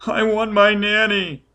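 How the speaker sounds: put-on high child wail; shrieking child. A narrator's reading. Scared child begging for his nanny, high wail. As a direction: cry for nanny like a frightened boy. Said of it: "The child was shrieking and shaking".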